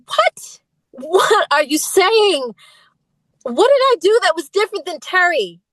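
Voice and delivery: retold distraught plea, pitched high; mock-upset protest, drawn out then rising; tearful pleading. Pleading with bandmate after being kicked out, retold as tearful protest. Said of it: "I was in tears".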